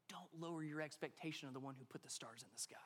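soft unvoiced whisper. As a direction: whisper it intimately, like to the heart. God speaking to the heart.

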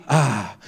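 low, acted relieved "ah"; mock relieved exhale; sigh of relief. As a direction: let out a pretend sigh, pitch dropping. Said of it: "he feels relief".

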